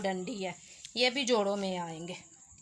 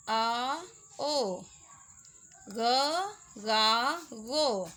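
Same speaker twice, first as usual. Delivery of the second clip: each syllable punched out, slightly louder; syllable drill; 4 dB louder, much slower than usual. Shown as a demonstration. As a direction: sound out the letter family for beginners. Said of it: "इसको हम कैसे read करेंगे". (ज़ोर देकर एक-एक अक्षर बोलना)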